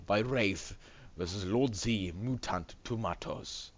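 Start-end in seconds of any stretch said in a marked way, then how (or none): 2.2-3.7 "Mutant" said MOO-tahnt, slowly; slow, literal "Mutant" with an oo and full second vowel; odd reading. Real, not acted. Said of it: "I'm reading it like mutant".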